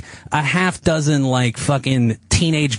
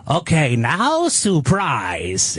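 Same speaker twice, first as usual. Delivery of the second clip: drawn-out mock announcement; 2 semitones higher, much slower than usual. Retells the owner's announcement.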